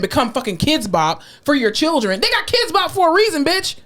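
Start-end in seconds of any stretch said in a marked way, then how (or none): 2.2-3.8 high-pitched indignant rant; heated scolding. Real, not acted. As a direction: tell off parents, pitch climbing.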